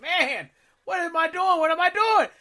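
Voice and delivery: high, loud self-questioning wail; mock panicked regret. Rider reenacting regret on the Mummy ride, high wail. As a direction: cry it out in exaggerated dismay.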